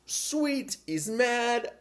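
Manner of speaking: high-pitched mock delight; gleeful. Kid delighted his big brother is angry, gleeful. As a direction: exclaim it high, like a kid pleased with mischief.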